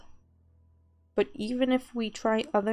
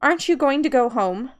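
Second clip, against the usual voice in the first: angry scolding; 5 dB louder than usual. Acted. Tori scolding the player for taking out the effigy, loud and angry. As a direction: scold him hard for ruining everything. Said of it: "He was really mad at me".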